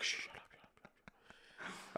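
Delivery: hushed library whisper. Retold or imitated. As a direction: shush each other like in a library. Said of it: "started whispering like we're in a library".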